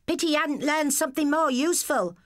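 high, sneering stepmother voice; sniffy. A narrator's reading. Stepmother sneers at the redundant husband, high and sniffy. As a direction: sniff down at him. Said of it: "with her special sniff".

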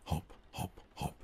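breathy whispered hop hop hop. Acted. Narrator whispering the rabbit's hops in the story.

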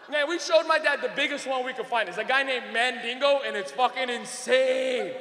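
ordinary talk

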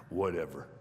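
very low, softened giant; big voice. Retold or imitated. Big guy relaxing his shoulders before the kid, deep voice. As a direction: drop it deep and let it go. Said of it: "I heard this big voice say".

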